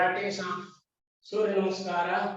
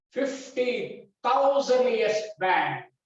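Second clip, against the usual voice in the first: fifty thousand said higher and slower, thousand stressed; proud emphasis; 3 semitones higher, slower than usual. Real, not acted. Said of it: "with proud, I repeat".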